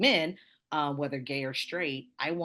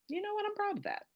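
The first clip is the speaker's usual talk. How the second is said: much higher, lightly voiced pride; proud defiance; 4 dB quieter, faster than usual. Answering Vegas teasing about being Southern, with proud defiance. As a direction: toss the insult back proudly. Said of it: "But I took it as".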